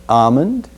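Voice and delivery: "almond" as ahmond, with no L; silent L. Shown as a demonstration. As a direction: drop the L in "almond". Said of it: "eliminate the L sound in almond".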